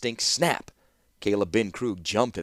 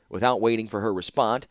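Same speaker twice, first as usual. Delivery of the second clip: usual speaking voice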